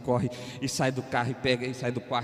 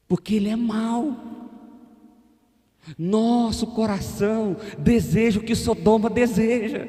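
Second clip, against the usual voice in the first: loud, high, driving preacher's cry; full-force preaching; 12 semitones higher, 9 dB louder than usual. Acted. Preacher crying out to the church about God's mercy. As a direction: build the sermon into a shout.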